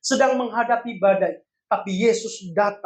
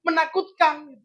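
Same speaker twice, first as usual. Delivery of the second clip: ordinary talk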